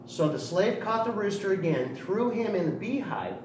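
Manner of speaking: usual speaking voice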